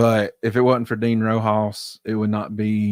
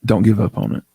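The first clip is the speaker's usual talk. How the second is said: sexy voice. Real, not acted. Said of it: "Why'd you say it like in a sexy voice".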